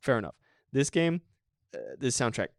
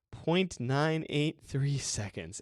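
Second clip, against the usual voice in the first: slow, deliberate; 3 semitones higher, much slower than usual. Shown as a demonstration. Repeating record time for emphasis.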